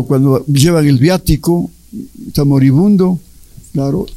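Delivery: ordinary talk, nothing marked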